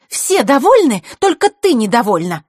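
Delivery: loud, high scornful jab; scoffing. Acted. Scene: Zakhara scoffing at the discontented guest, loud. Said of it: "фыркнула Захарра".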